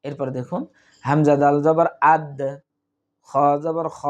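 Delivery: ordinary delivery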